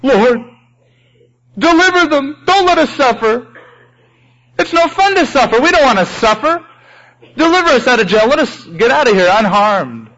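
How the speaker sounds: preacher mocking a self-pitying prayer, high and whiny; whiny selfish prayer. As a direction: whine like someone begging to escape suffering.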